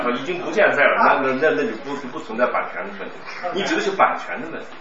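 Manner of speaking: ordinary delivery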